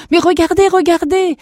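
high, loud cry to look; excited shout. Acted. Running boy to his companions, excited shout. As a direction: call out urgently, high and loud. Character cries out while running.

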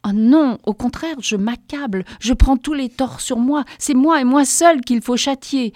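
fervent self-accusation. A narrator's reading. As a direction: pile the blame on yourself with zeal. Heroine takes all blame.

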